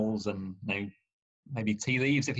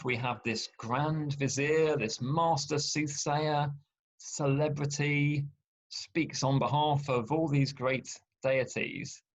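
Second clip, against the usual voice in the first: slow mock grandeur; 3 semitones higher, slower than usual. Mocking Balaam's grand titles.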